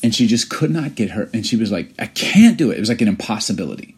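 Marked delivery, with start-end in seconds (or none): 2.0-2.8 put-on frustrated child. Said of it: "almost moved to tears".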